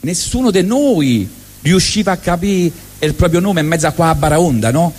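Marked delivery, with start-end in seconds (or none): none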